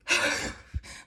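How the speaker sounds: big voiceless whoosh of breath; imitated heavy sigh; big exhale. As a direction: demonstrate a huge breath let out. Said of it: "I would let out a big".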